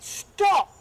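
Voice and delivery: loud high halt; commanding shout. Acted. Prince halts the mob about to kill Rose, commanding shout. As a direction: stop the crowd dead.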